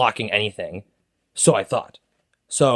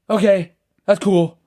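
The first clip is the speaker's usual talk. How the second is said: calmed down; 7 semitones higher, 4 dB louder, slower than usual. Retold or imitated. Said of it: "he's like".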